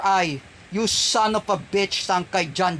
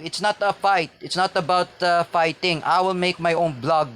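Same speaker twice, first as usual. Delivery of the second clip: ordinary talk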